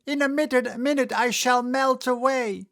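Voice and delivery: high, loud frantic shriek; panicked witch. A narrator's reading. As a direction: cry out as you melt. Witch melting.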